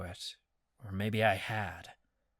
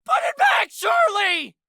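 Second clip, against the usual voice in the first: much higher, louder pained cry; anguished shout; 9 dB louder than usual. Acted. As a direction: shout his name in pain. Marcus shouting in pain.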